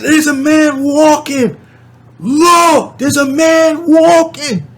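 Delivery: slow high shouted warning; screaming. Retold or imitated. Pointing out the man in the sky to strangers, slow high shout. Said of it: "So I'm screaming".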